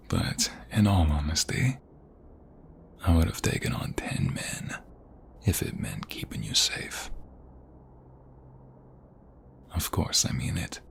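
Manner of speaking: put-on ASMR boyfriend whispering tenderly; intimate whisper. Whispered promise of protection.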